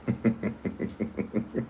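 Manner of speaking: soft, voiceless laugh; quiet snicker. Real, not acted. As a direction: snicker under your breath for a moment. Laughs between jabs at viewers.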